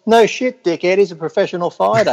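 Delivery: scornful outburst, pitched well up; exasperated retort. Retold or imitated. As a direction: throw it back at a clueless challenger. Exasperated retort to imagined challengers.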